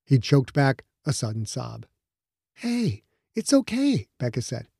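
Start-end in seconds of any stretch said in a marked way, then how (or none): 2.5-4.1 slow, softer and higher, soothing; soft reassurance. Acted. Becca soothing Quentin as he chokes back a sob, softly. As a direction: ease him gently into letting go.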